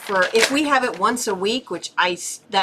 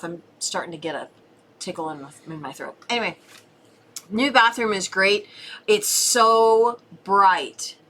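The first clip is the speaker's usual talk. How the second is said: ordinary talk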